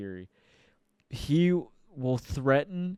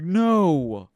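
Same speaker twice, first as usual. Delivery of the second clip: firm no; 4 semitones higher, 9 dB louder than usual. Retold or imitated. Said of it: "He's like".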